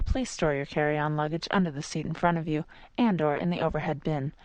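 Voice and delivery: normal talking voice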